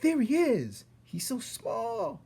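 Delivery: high, slow squeal of a small mouse; excited squeaky mouse. Acted. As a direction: gasp it out like an awed little mouse. Excited mouse voices in crowd.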